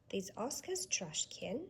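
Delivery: plain reading voice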